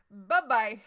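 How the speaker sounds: Bye-bye said loud and falling, dismissive. Retold or imitated. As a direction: shut him down with a loud "Bye-bye". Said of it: "be like".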